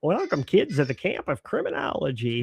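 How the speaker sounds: put-on camp host greeting the kids; camp counselor welcome.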